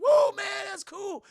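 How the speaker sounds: very loud, high whoop; hype whoop. Retold or imitated. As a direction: whoop with the thrill of speed. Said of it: "they're like".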